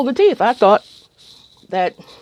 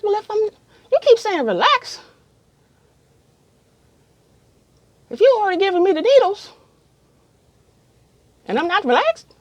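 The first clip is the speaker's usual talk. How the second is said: much higher, teary and pleading; tearful protest; 13 semitones higher than usual. Retold or imitated. Crying patient protesting to dentist, high and teary. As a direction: argue through tears.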